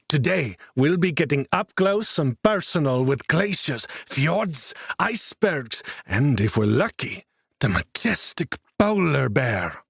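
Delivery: high, bright cheery tour guide voice; cheerful guide. A narrator's reading. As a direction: chirp it out like an overeager guide. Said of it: "began detailing the day's itinerary with a level of cheer".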